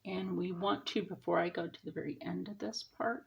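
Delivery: ordinary delivery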